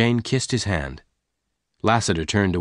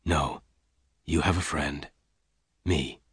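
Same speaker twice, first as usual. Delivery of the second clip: softer, lower tender voice; gently; 5 semitones lower, 7 dB quieter than usual. A narrator's reading. Lassiter comforting lonely Jane, gently. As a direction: comfort her, softly. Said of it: "Lassiter said gently".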